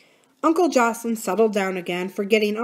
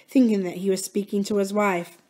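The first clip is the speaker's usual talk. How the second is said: plain reading voice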